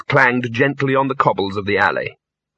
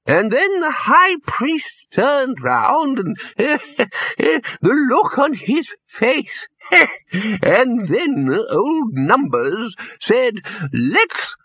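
put-on high old voice breaking into wheezy laughter; an old man cracking himself up; slow, high, cackling through the words; laughing old wizard; 11 semitones higher, much slower than usual. A narrator's reading. Poons laughing at his story.

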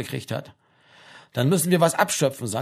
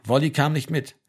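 ordinary delivery

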